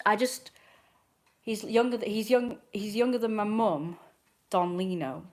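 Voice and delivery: normal talking voice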